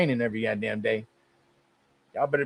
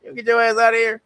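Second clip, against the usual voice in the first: mock indignant; 7 semitones higher, 4 dB louder, faster than usual. Real, not acted. Mock outrage at the quote.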